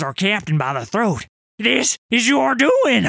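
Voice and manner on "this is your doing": loud, slow furious roar. Retold or imitated. Sailor choking his captain as the Titan rises, loud roar. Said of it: "I roared".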